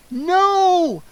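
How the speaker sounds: long high loud wail of despair. A narrator's reading. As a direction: wail it as the door slams. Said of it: "Kara and I both wailed".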